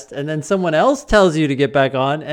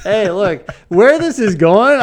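excited; 8 semitones higher, 11 dB louder, slower than usual. Real, not acted. Laughing over the bad phrasing.

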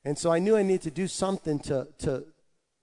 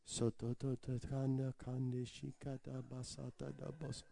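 much softer, fast tongues syllables; soft rapid babble; 4 semitones lower, 17 dB quieter than usual. Retold or imitated. Testing if he can go fast, quietly babbling. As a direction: rattle it off almost under your breath. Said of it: "Can I go so".

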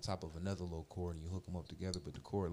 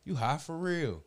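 rising louder and higher to a slow loud finish; warning a stoned friend, getting louder; building exclamation; 4 semitones higher, 6 dB louder, slower than usual. Retold or imitated. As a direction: build it up, then land it slow. Telling friend he's too high.

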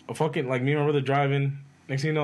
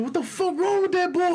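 much higher, imitating the brother's yelp; brother's outburst. Brother reacting to the reckless driver, high mock yell. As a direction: squeal the brother's shocked line. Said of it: "And then my brother".

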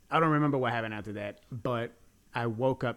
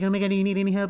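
high, loud mock concern; hammed-up fussing woman; over-helpful woman; 8 semitones higher, 8 dB louder, much faster than usual. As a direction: imitate her frantic offer to help.

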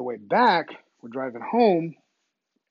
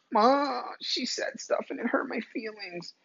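whiny high tattletale, trailing off softer; hammed-up tearful complaint to Ma; mock crybaby; 6 semitones higher, 5 dB quieter, slower than usual. Mocking crybaby telling Ma on someone, whiny. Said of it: "Stop crying".

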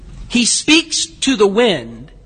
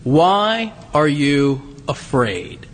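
gentle question; 7 semitones lower, much slower than usual. Retold or imitated. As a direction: ask it tenderly, slowly. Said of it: "Jesus says to them".

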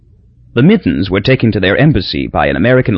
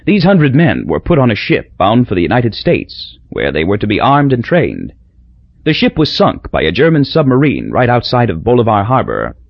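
ordinary delivery

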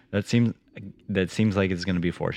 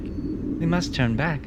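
put-on high, slow girl voice; little girl; 9 semitones higher, slower than usual. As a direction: play a girl reluctantly giving up, drawn out. Voices Dorothy talking to Toto.

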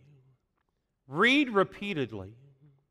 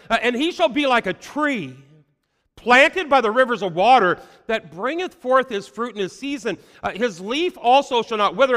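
scripture reading building to proclamation; 2 semitones higher, 4 dB louder than usual. Acted. Preacher reading Psalm one to his congregation, swelling to a louder proclamation. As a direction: start calm, build to a ringing, louder close.

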